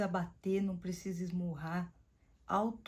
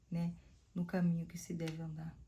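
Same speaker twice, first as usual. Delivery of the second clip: normal talking voice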